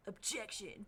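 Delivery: a hint of a deep, gruff prosecutor; lightly voiced gruff lawyer; deep gruff objection. Streamer imitating the prosecutor's objection, lightly deep and gruff. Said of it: "Very deep, very gruff".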